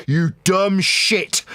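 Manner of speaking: normal talking voice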